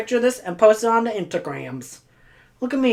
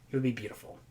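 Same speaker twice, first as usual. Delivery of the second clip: It would be beautiful, said much softer and lower; soft wistful echo; 6 semitones lower, 12 dB quieter, much faster than usual. Real, not acted. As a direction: sigh it out to yourself.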